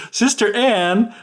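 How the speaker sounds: high, drawn-out mock call; slow singsong call, not really shouted. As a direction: imitate calling up to a sister. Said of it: "she calls out to her sister saying".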